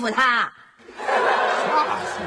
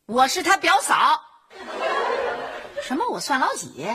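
higher, fierce scolding of the workmen; retold telling-off; 4 semitones higher than usual. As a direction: lay into them like a tough big sister. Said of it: "我跟他说". (重演时高声泼辣地训斥)

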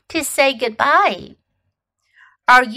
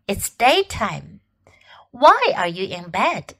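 whispered, no voice at all; 2 semitones lower than usual. Shown as a demonstration. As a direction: breathe the line as a secret. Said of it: "teacher repeats, whispered".

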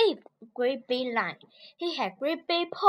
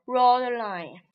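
ordinary delivery

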